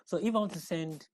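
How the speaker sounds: ordinary delivery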